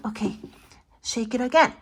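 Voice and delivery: plain reading voice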